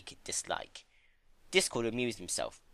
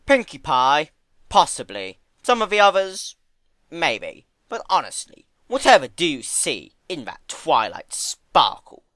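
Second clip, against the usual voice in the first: dismissive Discord; 6 semitones higher, 10 dB louder than usual. Acted.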